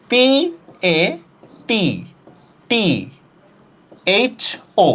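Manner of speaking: each letter of P A T T H O set apart by a long pause; spelling out. Shown as a demonstration. Teacher showing how to write a pronunciation, spelling out slowly. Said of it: "পাঠ্য শব্দের উচ্চারণটা লিখি".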